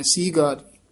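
"ਸੀਗਾਰੀ" with a long see; long first vowel. Shown as a demonstration. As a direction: lengthen the opening ee of "ਸੀਗਾਰੀ". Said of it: "ਦੀਰਘ ਉਚਾਰਨ ਕਰਨੀ ਹੈ".

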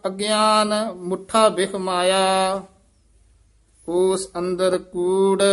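measured chant-like reading with drawn-out line endings; scripture recitation. Shown as a demonstration. Teacher reciting Gurbani for students, measured chant. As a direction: intone each line on a level note.

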